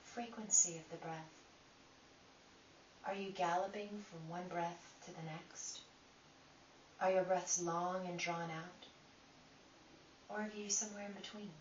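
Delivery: calm, unhurried guiding voice with long pauses; guided meditation. Acted. Teacher guiding listeners through breath inquiry, calm meditation voice. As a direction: lead them inward one gentle question at a time.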